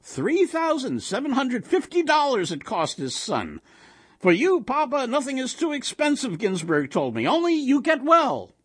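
put-on high, marveling old husband; animated Yiddish-inflected kibitzing; gossiping old man. A narrator's reading. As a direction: carry on the anecdote, pitch way up. Old man continues gossip, quoting friend.

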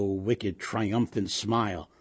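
plain reading voice

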